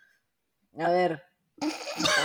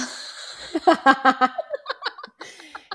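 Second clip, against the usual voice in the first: long laugh; 6 semitones higher than usual. Real, not acted. Big laugh at friend.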